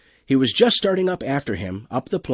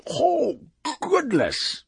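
much higher, drawn-out creature exclamation; wub crying out; much slower than usual. A narrator's reading. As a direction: cry out in dismay as the beast. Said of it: "the wub cried".

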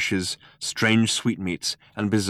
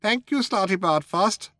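high mouse voice, firm; firm dismissal; 11 semitones higher than usual. A narrator's reading. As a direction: firmly close the matter as a high-voiced mouse. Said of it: "said Benji firmly".

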